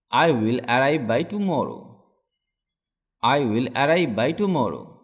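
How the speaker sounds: usual speaking voice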